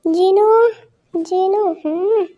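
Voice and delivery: loud call across the house, rising at the end. Acted. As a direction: shout her name to find her. Calling out for Jin.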